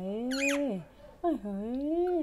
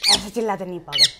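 louder, fast telling-off; quick scolding; 4 semitones lower, 7 dB louder, much faster than usual. Real, not acted. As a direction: snap at the bird fast, half fond. Scolding the screeching parrot. (तोते को जल्दी-जल्दी डाँटना)

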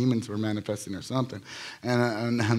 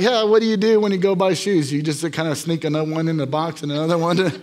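joking tease; 5 semitones higher, 7 dB louder than usual. Retold or imitated. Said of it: "I like to joke".

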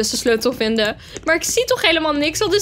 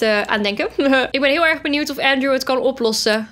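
normal talking voice

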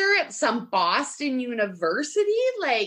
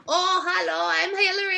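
mock Spanish accent; 5 semitones higher than usual. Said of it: "with her little Spanish accent".

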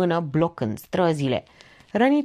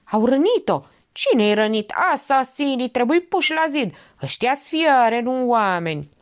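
higher-pitched crowd cries; acted outcry; 6 semitones higher, 3 dB louder than usual. A narrator's reading. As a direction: call out like several outraged onlookers. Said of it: "declanșând un cor de urlete". (strigăte indignate din mulțime)

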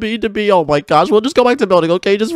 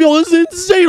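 excited; 9 semitones higher than usual. Real, not acted. Amazed at having every block.

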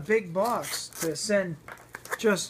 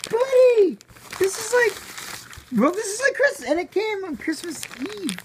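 overjoyed, laughing through the words; giddy excitement; 9 semitones higher than usual. Real, not acted. Thrilled unboxing a Christmas gift.

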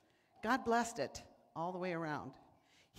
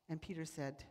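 ordinary delivery

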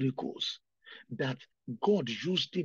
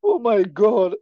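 louder wailing sobs to God; crying out loud; 4 semitones higher, 11 dB louder, slower than usual. Real, not acted. Weeping preacher cries aloud to the Lord. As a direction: let the sobbing overtake the words. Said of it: "The reason why I'm crying".

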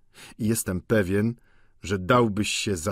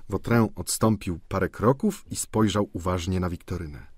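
ordinary delivery

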